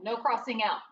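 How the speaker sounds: normal talking voice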